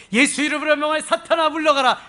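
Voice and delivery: high, loud exorcising command; re-enacted casting out of Satan; commanding rebuke. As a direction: build from accusation to forceful banishing.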